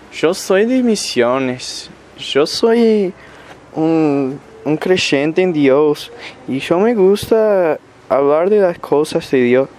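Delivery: put-on high, slow, sing-song northern Argentine Spanish accent; Misiones Spanish accent. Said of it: "it literally sounds like that".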